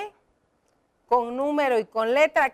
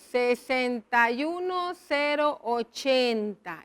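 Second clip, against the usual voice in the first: slow dictation; 3 dB quieter, slower than usual. Shown as a demonstration. Dictating the postal code digit by digit.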